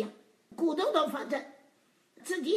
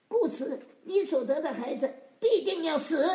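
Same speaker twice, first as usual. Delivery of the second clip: loud, raised pronouncement; emphatic scripture reading; 4 semitones higher, 6 dB louder than usual. Acted. Preacher reads out the sentence on David's child, forceful scripture reading.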